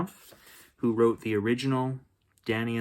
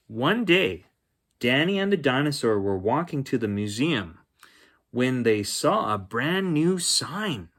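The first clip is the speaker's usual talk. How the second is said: bright, lilting read-aloud voice; storybook narration; 3 semitones higher, 3 dB louder than usual. A narrator's reading. Uncle reading a bedtime story to children, bright and lilting.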